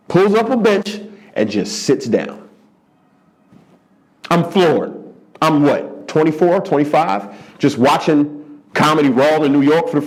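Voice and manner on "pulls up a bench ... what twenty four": ordinary delivery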